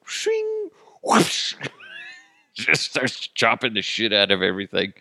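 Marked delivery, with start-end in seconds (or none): 0.1-0.7 high ringing blade noise; imitated sword shing. Queen drawing her sword in Subway, imitated shing. 1.0-1.5 airy, voiceless swishing noise; imitated sword whoosh. Queen chopping a sub with a sword, imitated whoosh.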